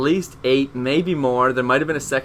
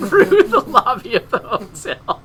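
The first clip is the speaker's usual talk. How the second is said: laughing hard; 13 semitones higher than usual. Real, not acted.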